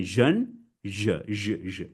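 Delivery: "je" as a held buzzing zh; isolated sound drill. Shown as a demonstration. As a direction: repeat "je" deliberately, zhuh, zhuh. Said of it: "So once again".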